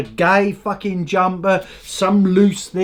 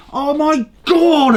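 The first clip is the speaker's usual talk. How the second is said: exasperated outburst; 9 semitones higher, 3 dB louder, slower than usual. Real, not acted. Exasperated outburst mid rant.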